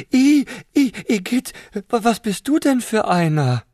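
high, loud squealing voice full of disgust; disgusted shrieking. Acted. Prawn recoiling from the slug, high disgusted squeal. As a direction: squeal it out, repulsed. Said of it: "schrie sie".

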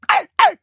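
high, loud yaps; dog barking. A narrator's reading. Teddy the dog somewhere nearby, barking. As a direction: yap like a dog. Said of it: "Teddy bellte irgendwo in der Nähe".

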